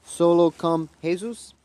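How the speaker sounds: slow, loud questioning attempt; tentative sounding-out. Real, not acted. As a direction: try the foreign phrase word by word, asking if it works. Testing a guessed Spanish phrase.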